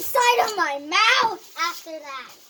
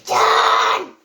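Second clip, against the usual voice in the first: triumphant shout; 6 dB louder than usual. Real, not acted. Unvoiced shouted.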